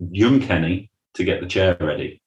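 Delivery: usual speaking voice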